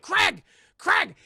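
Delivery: very high, loud yell; yelling his name. Retold or imitated. Wife yelling for her husband, high loud yell. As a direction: shout for him urgently.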